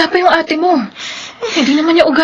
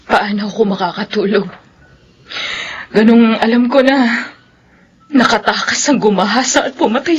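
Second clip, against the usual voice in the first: weary grief; 9 semitones lower than usual. Acted. Sleepless grieving mother.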